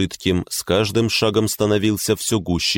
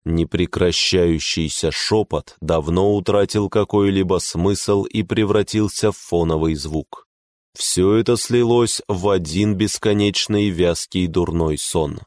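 ordinary delivery, nothing marked

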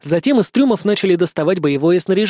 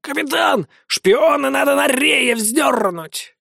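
much higher, slow shouted demand; fierce parrot shout; 8 semitones higher, slower than usual. A narrator's reading. Parrot demands hanging the spy, high shout. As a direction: bellow it like a pirate parrot.

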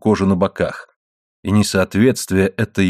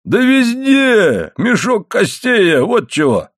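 high-pitched, drawn-out indignant exclamation; emphatic retort; 12 semitones higher, 3 dB louder, slower than usual. A narrator's reading. Husband retorts to wife about his bones, emphatically indignant. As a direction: stretch the first words high, then come down.

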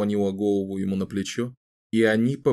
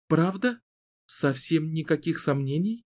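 put-on light, teasing young woman; playful Kahlan; 6 semitones higher than usual. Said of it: "одарила его своей особой улыбкой".

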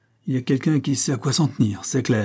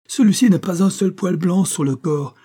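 higher and louder, desperate; despairing cry; 8 semitones higher, 5 dB louder than usual. A narrator's reading. Colonel cries out to Holmes in despair. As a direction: cry out in dismay. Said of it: "s'écria avec désespoir".

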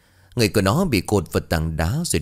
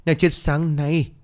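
tearful; 8 semitones higher, slower than usual. Acted. Grieving over Hiền's death.

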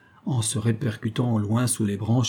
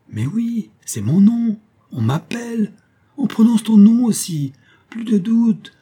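high, urgent exclamations growing louder; breathless-with-hope outburst in a raised high voice; mounting excitement; 11 semitones higher, 6 dB louder than usual. A narrator's reading. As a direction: realise with rising amazement that help is near. Hero hears his name called.

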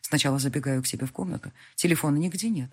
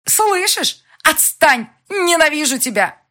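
higher, louder raging shriek; hateful scream; 11 semitones higher, 9 dB louder, slower than usual. A narrator's reading. Elvira screaming hatred at the heroine, furious. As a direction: hurl the hatred at her.

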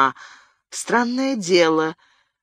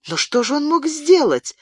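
pressing question, pitched much higher; insistent high-pitched demand; insisting; 12 semitones higher than usual. A narrator's reading. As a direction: push him for an answer. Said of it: "добивалась Бабетта".

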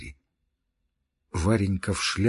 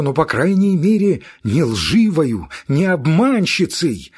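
higher, louder, fierce declaration; fit of temper; 7 semitones higher, 7 dB louder, slower than usual. Acted. Girl in a fit of temper, fierce outcry. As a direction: fling it out in a temper. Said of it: "в своём припадке вспыльчивости".

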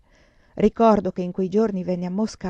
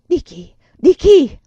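much higher, much louder urgent question; alarmed demand; 8 semitones higher, 13 dB louder, slower than usual. A narrator's reading. Prilukov demanding to know who frightened her.

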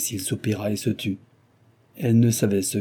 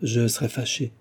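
ordinary delivery, nothing marked

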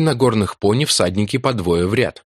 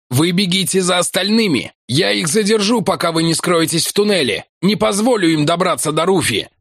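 shouting; 9 semitones higher than usual. Acted. Ventner shouting plan.

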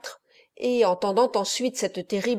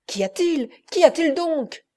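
anxious repeat; 7 semitones higher than usual. A narrator's reading. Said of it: "répéta Agnese".

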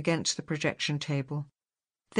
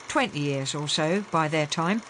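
usual speaking voice

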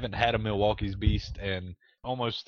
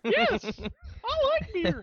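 put-on very high squeaky kid voice; young boy voice; slower than usual. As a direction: pitch way up like a little boy. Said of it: "your future post-pubescent self".

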